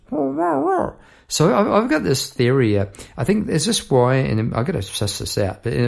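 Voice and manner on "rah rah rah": high, wordless far-off murmur; distant garbled voice. Acted. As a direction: mimic words blurred by distance. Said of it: "and it comes across as".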